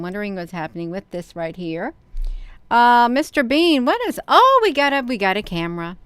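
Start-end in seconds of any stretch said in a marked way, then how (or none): 2.7-6.0 surprised. Real, not acted. Camera suddenly pops up.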